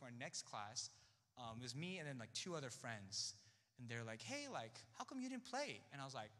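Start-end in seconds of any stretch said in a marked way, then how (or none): 4.2-5.8 higher, lightly voiced curious friend; imitating friends' question. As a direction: mimic a friend asking casually, pitched up.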